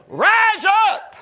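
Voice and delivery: high, loud command to rise; commanding shout. Retold or imitated. Preacher voicing Jesus speaking into the man's spirit, commanding shout. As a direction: call it out with full force. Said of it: "he spoke into the man's spirit".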